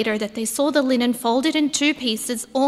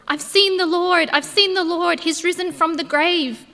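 breathless, higher and louder, bursting with news; excited announcement; 6 semitones higher, 4 dB louder than usual. Acted. Mary runs to tell the disciples, excited.

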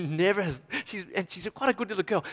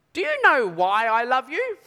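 put-on high, slow little girl asking; sweet child voice; 6 semitones higher, 4 dB louder, slower than usual. Toddler in the back seat sweetly coaxing her dad. Preacher voicing toddler daughter.

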